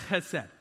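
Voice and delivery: chesed with a throaty h at the start; Hebrew chesed. Shown as a demonstration. Pastor leading the church to say chesed, throaty ch. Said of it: "I want us to say chesed".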